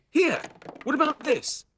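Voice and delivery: very high, excited squeak; Scampy's squeaky voice. A narrator's reading. Scampy squeaks to Sooty about his find. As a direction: pitch right up like a puppet. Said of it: "said Scampy".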